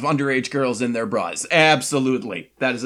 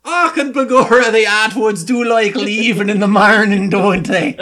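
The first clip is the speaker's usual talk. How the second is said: mock Irish brogue, higher and louder; hammy stage Irish lilt; leprechaun; 7 semitones higher, 5 dB louder than usual. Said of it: "I apologize to everyone".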